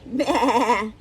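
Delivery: loud wobbling bleat; imitated baa with a quaver; sheep bleat. A narrator's reading. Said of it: "Sheep said".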